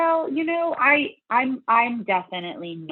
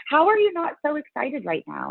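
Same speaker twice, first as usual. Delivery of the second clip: high-pitched mock incredulity; re-enacted baffled excitement; incredulous friend; 9 semitones higher than usual. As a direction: imitate an excited friend who can't believe it.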